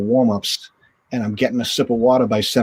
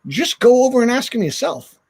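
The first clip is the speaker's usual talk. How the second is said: higher voice, breezy mock teammate; teammate egging him on; 11 semitones higher than usual. Retelling a teammate telling him to ask Blake himself, higher and breezy. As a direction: nudge him like a pushy teammate.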